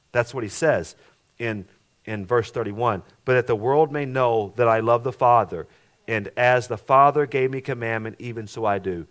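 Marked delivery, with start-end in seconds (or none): 3.2-9.1 scripture reading. Acted. Reads scripture in preaching voice.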